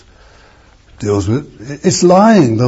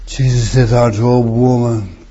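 ordinary talk, nothing marked

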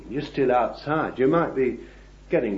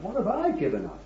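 higher, fast, whiny complaint; mock self-pity; 7 semitones higher, 3 dB quieter, faster than usual. Preacher mimicking a self-pitying disciple, higher and fast. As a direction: play the martyr, a touch mocking. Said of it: "he could have said for you".

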